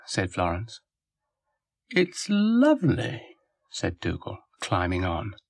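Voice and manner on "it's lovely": high, drawn-out admiration; delighted dog character. A narrator's reading. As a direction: stretch it out in a high, pleased voice. Dougal admires the roundabout.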